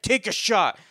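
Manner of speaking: barked order. Retold or imitated. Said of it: "He's like".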